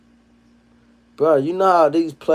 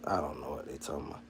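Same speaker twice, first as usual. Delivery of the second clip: muttered aside; 13 semitones lower, 20 dB quieter, faster than usual. Real, not acted.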